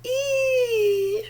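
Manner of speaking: long high elephant squeal; imitated trumpeting on a held "ee".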